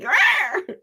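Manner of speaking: mock growl with a laugh; a laughing little monster roar; playful rawr. Psychic mimicking the startled dog with a laughing rawr. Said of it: "It's like".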